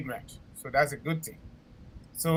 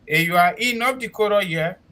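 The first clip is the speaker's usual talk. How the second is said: ordinary talk, nothing marked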